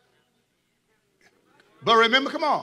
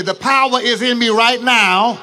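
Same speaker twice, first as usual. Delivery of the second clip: loud, slow, emphatic proclamation; preacher leading the crowd in a shouted confession; shouted declaration; 5 dB louder, slower than usual. Shown as a demonstration. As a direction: declare it with full force. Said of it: "Come on, say".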